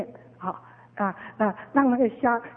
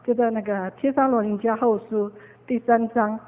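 ordinary delivery

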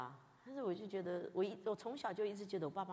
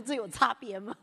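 loud, baffled "what's the difference?"; bewildered question; 3 semitones higher, 8 dB louder than usual. Retold or imitated. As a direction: act truly puzzled by two lines. 在心里对牧师的反问.

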